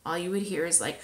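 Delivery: ordinary delivery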